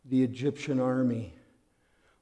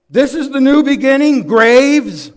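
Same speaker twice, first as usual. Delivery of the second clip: loud, higher mock accusation; angry complaint; 11 semitones higher, 15 dB louder than usual. Israelites complaining to Moses, loud and angry. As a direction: hurl it at Moses in bitter protest. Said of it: "They're angry. They're complaining".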